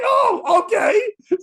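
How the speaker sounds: high, loud, cracking through laughter; laughing reply. Real, not acted. Retelling his reply to the killer threat, laughing. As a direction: squeak it out while laughing.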